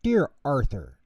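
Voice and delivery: usual speaking voice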